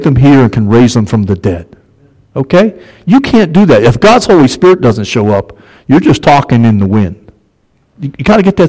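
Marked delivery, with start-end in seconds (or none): none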